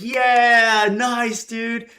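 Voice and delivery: high, loud cheering; mock crowd cheering high and loud. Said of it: "everyone's like".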